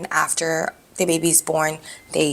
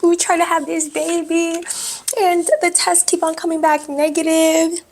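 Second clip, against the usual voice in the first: high, louder, distressed retelling of her own plea; retold pleading to doctor; 10 semitones higher, 4 dB louder than usual. Telling her immunologist the tests keep failing, pitched-up plea. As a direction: replay the desperate plea, pitched way up. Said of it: "And I was just like".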